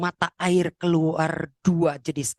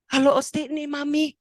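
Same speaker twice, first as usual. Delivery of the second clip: high, eager phone greeting; acted high-pitched hello; excited mother; 8 semitones higher, 3 dB louder than usual. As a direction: call out to a long-lost son on the phone. Mother first phoning lost son.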